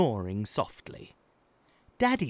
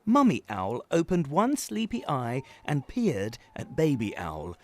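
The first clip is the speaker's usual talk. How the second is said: plain reading voice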